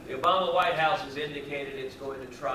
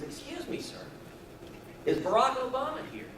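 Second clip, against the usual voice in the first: higher, quiet and innocent; innocent old man; 8 semitones higher, 5 dB quieter than usual. Acted. Old man on his third visit, asking innocently. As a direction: ask it sweetly, playing dumb.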